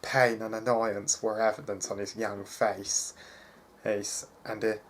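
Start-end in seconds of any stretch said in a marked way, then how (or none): none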